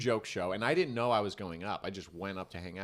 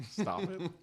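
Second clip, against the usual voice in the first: stop it; 9 semitones higher than usual. Retold or imitated. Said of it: "he was like".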